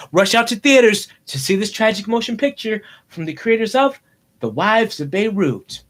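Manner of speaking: dramatic trailer voiceover with weighty pauses; movie trailer announcer. Pretend. Boy announcing his imagined film, mock trailer voice. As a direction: sell it like a summer blockbuster.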